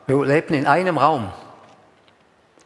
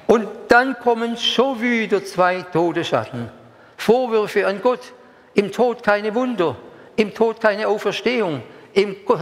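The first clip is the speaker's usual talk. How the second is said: higher, slow, intoned lament; 7 semitones higher, slower than usual. Acted. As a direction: chant each loss slowly, mournfully. (langsame, hohe Klage)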